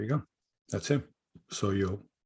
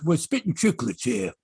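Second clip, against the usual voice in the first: put-on higher-pitched Boston accent; 5 semitones higher than usual. Co-host imitating a podcaster naming his show, Boston accent. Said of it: "He's got a Boston accent".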